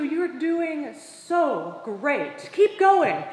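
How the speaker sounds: high, loud, stretched-out praise; gushing encouragement. Retold or imitated. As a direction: cheer her on like a fan. Enthusiastic woman cheers her on.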